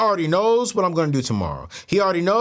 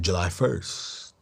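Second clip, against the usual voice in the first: low, soft, drawn-out answer; slow mock-psychic reply; mimicking the psychic; 12 semitones lower, 7 dB quieter, much slower than usual. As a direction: copy her mysterious voice, slowly. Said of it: "Because you're talking like that".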